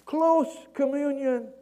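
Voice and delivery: high, loud, slow call; acted distant shout; yelling down. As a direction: holler the answer down, drawing it out. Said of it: "the guy yells down".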